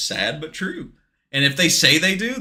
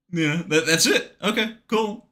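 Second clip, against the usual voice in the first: usual speaking voice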